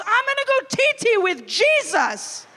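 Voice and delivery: put-on high, loud toddler shout; hammed-up little kid shouting. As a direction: yell it across the church like a proud kid. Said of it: "my two and a half year old shouts".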